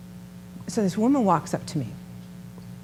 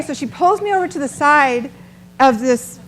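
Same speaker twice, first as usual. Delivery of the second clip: ordinary talk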